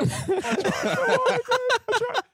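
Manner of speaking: laughing lament. Real, not acted. Laughing lament about trying.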